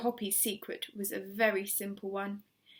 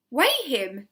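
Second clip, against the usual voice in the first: order; 7 dB louder than usual. A narrator's reading. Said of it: "Mister Hoppy ordered".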